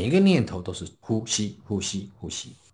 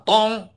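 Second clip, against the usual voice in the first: a sharp, high spoken "dong" for the staff; mock thud; 11 semitones higher, 5 dB louder than usual. Master tells the thump of the staff, high mock thud. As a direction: bang the staff down with your voice, higher.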